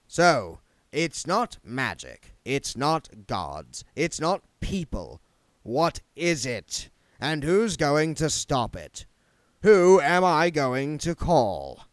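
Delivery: the city's ruler, fed up, to the crowd; exasperated lord. Acted. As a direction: throw the questions out in frustration, slowly.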